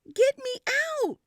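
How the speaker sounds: put-on a kid yelling from the trolley; shouting. A narrator's reading. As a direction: scream to be let out. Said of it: "I shouted".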